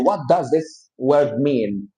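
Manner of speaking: slow, careful teacher model; each word set apart, unhurried; slow model sentence. Shown as a demonstration. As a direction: spell it out slowly for the class. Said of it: "You can say".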